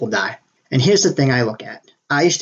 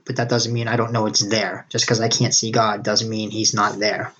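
ordinary delivery

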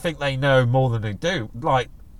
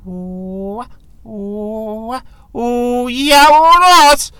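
drawn-out hoots building to a loud high howl; long rising monkey whoops erupting into a roar; howler monkey call; 10 semitones higher, 9 dB louder, much slower than usual. Retold or imitated. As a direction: imitate howler monkeys whooping, then bellowing. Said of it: "you hear 'em go".

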